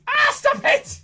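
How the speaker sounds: high, loud playful cry; mock protest. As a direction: fake outrage at the puppet. Puppet misbehaving.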